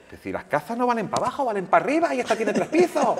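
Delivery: put-on high, loud Andalusian accent of a gitano singer. Flamenco singer scoffing at reading to the reporter, Andalusian accent. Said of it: "con su acento así".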